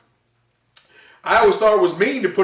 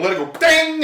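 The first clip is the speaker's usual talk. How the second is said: ding sound; 9 semitones higher than usual. Retold or imitated. Cabinet bouncing back on rubber band.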